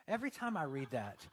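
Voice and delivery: usual speaking voice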